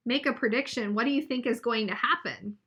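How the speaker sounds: plain reading voice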